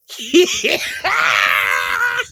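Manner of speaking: rising laugh-cry shriek; mortified laughing shriek. Real, not acted. Vlogger recalling her blunder, laugh-crying shriek.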